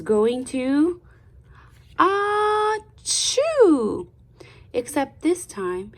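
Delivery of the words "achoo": loud high acted sneeze; big sneeze. Storyteller acting out Louise's sneeze, loud. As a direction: throw out a huge sneeze.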